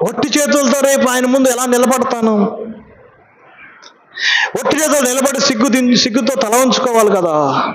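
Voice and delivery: mild, high-pitched lament; retold grief. Dying young believer confiding his shame to the pastor, mildly acted grief. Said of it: "నా దుఃఖము అది sir".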